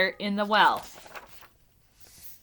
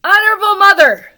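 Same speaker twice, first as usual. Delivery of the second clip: very high, loud, gasping for breath; 8 semitones higher, 14 dB louder than usual. A narrator's reading. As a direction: choke the words out between gasps. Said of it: "he gasped".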